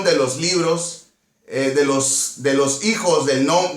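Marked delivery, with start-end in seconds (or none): none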